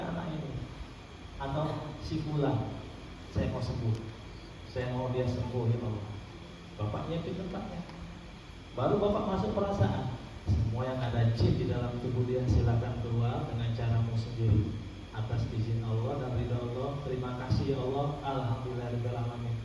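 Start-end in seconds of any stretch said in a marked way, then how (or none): 1.4-6.1 low, soft plea to God; murmured healing prayer, pitched low; quiet demonstrated prayer. Shown as a demonstration. 10.5-19.6 low, soft recited command; understated healer's prayer; quiet exorcism prayer. Shown as a demonstration. Demonstrates the command to the jinn.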